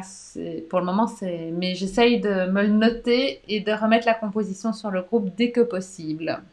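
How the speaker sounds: ordinary delivery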